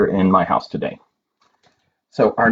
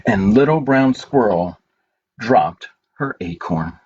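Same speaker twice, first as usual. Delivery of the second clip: gentle, unhurried picture-book reading with long pauses; reading to children; slower than usual. Acted. Reading A Good Day to children at story time, gentle and slow. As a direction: read it slowly to little ones, letting each page land.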